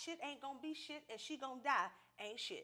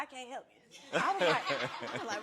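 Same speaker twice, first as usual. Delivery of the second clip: ordinary delivery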